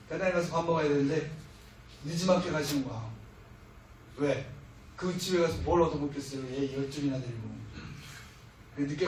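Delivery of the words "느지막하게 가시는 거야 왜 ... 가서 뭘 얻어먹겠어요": ordinary delivery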